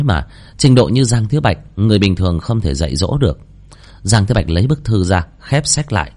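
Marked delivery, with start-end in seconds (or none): none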